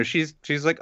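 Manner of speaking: normal talking voice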